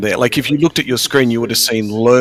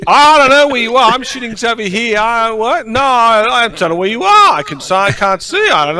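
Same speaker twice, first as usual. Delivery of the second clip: mock panicked gamer; 9 semitones higher than usual. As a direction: play yourself lost and panicking. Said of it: "But if it was me".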